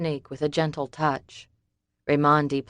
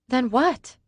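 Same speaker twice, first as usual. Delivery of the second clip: higher, insistent question; Summer's voice; 9 semitones higher than usual. A narrator's reading. Summer pressing David, anxious and high. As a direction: press him for an answer.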